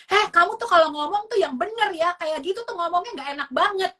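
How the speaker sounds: high-pitched parental telling-off; acted indignant reprimand; angry scolding. Shown as a demonstration. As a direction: snap at the child in a high, scolding voice.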